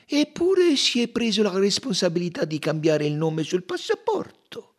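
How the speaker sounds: high, pointed detective voice; lightly voiced detective insisting; Poirot's voice.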